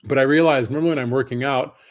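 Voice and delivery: usual speaking voice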